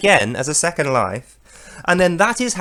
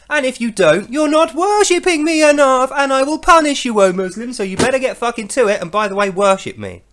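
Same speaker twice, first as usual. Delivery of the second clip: higher, louder hammed-up scolding; mock threatening tirade; mock angry god; 6 semitones higher, 3 dB louder than usual. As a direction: rant like a furious deity.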